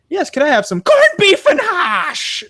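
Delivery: very high, loud, drawn-out mock Irish; overdone Irish accent. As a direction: ham it up wildly as Irish. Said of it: "I'm, I'm Irish".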